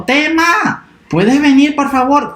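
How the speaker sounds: voz de mamá más aguda, llamando; llama dulce pero alto al niño. Acted. (la mamá llama a Tema con voz aguda)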